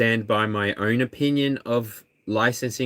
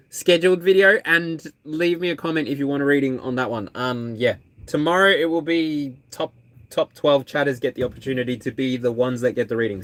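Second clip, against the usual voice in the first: ordinary talk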